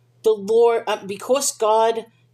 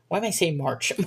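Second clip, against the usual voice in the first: ordinary talk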